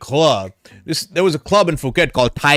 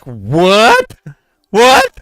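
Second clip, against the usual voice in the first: shocked disbelief; 7 semitones higher, 7 dB louder than usual. Retold or imitated. Stunned reaction in retold story.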